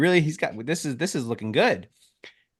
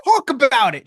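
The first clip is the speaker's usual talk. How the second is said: high, louder frustrated protest; exasperated scolding; 13 semitones higher, 5 dB louder than usual. Retold or imitated. To his sister at the game, high loud scolding. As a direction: tell her off for breaking the rule.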